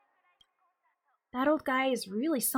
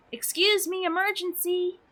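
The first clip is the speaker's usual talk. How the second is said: a hint of a panicked helper, pitched up; high urgent interruption; 9 semitones higher than usual. Helper interrupting a conversation, high and urgent. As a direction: cut in with a raised, urgent voice. Voicing game dialogue.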